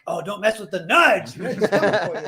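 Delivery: big pro wrestler promo voice; babyface wrestler. Retold or imitated. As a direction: taunt like a wrestler hyping the crowd. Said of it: "You do a good pro wrestler voice".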